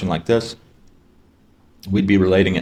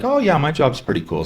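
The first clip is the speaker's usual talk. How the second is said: high, casual fake cheer; mock upbeat dodge; breezy brush-off; 8 semitones higher than usual. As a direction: wave it away lightly. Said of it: "they're like".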